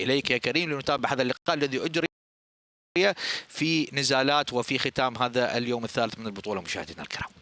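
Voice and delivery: normal talking voice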